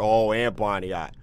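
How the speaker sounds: ordinary talk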